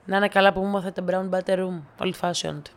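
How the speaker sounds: normal talking voice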